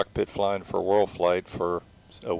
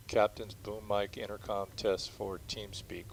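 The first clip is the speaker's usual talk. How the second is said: usual speaking voice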